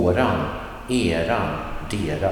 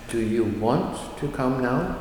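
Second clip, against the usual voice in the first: usual speaking voice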